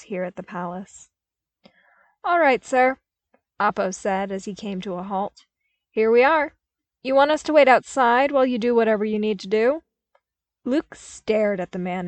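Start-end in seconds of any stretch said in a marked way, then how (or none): 2.2-9.8 loud fast soldier question; brisk trooper. A narrator's reading. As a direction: report in crisply.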